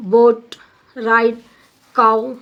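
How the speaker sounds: BOAT, RIDE, COW as separate, emphatic example words; teacher demonstrating the gliding vowels in boat, ride, cow; diphthong examples. Shown as a demonstration. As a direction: pronounce boat, ride, cow one by one, vowel glides clear. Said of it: "examples of diphthongs include the sounds in".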